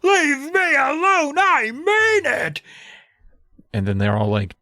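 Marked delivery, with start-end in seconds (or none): 0.0-2.6 high, strained retold scream. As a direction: scream it out in desperation, drawing out the end. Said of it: "And he screams".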